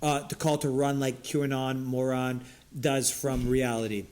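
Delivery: usual speaking voice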